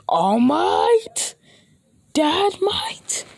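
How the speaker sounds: high, loud incredulous call; acted outburst. Acted outburst in fight scene.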